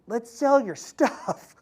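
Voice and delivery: a hint of a sly mentor pushing the sale; slow sly suggestion. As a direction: stretch it out with a knowing grin. [name] repeats the offer.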